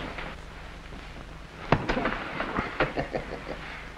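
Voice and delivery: a drawn-out villain's chuckle; soft, pleased evil snicker; long amused chuckle. Acted. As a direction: laugh low and long at the joke. Flat Top enjoys henchman's joke.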